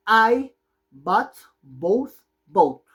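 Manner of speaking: slow model sentence. Shown as a demonstration. Teacher modeling the sentence very slowly for class. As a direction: spell it out slowly for learners. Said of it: "¿Cómo se pronuncia esa oración?".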